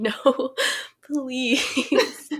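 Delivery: mild mock scream, breaking into laughter; drawn-out mock plea; imitated scream. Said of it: "Someone was straight up screaming".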